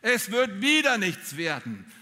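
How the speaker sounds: nagging inner voice. Retold or imitated. The preacher voices the inner voice that shouts failure into your ear, slow and loud. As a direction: lay the doubts on slowly and loudly. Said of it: "die brüllt dir ins Ohr".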